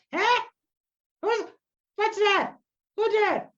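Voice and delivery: high, loud startled elder; hard-of-hearing old druid. Acted. Hard-of-hearing druid hears Druidic spoken nearby, startled high cry. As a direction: call out sharply as if unsure what you heard. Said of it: "she doesn't hear very well anymore".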